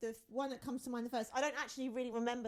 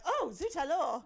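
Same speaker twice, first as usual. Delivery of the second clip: mock French; 7 semitones higher, 8 dB louder than usual. Teasing mock prim French exclamation.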